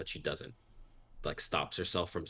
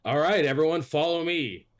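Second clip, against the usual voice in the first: loud, higher rallying call; 9 semitones higher, 7 dB louder than usual. Acted. Guard calling everyone to follow him to Kresk, loud call.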